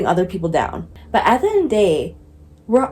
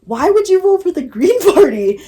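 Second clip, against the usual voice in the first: laughing disbelief; 10 semitones higher, 6 dB louder than usual. Retold or imitated. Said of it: "he was trying not to laugh".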